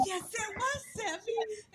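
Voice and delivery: high, soft whimper; retold tearful squeak, comically pitiful; mock-tearful protest. Singer answering producer after the hard take, mock-whimpering. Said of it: "I went in there and I cried".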